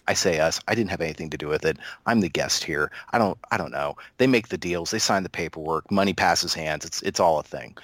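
normal talking voice

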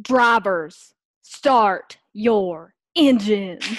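slow, careful attempt at accentless American; trying to lose Southern accent. Pushing hard to kill the accent, careful non-Southern accent. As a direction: enunciate every R, hiding a Southern accent. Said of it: "without any Southern accent".

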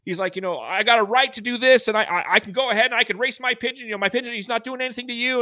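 imitating his father yelling at the neighbor; dad cussing out neighbor. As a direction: tell the neighbor off in a hammed-up fury. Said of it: "started cussing the guy out".

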